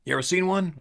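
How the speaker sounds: put-on high, curious old driver asking; cab driver's voice. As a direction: pitch up into the cabbie's friendly question. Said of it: "He goes".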